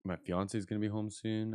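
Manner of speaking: ordinary delivery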